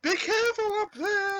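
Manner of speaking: high-pitched mock onlooker's call; mock worried warning. Calling to the dancer on the cliff, mock warning. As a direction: squeal it like a comic bystander. Voicing an onlooker in retold scene.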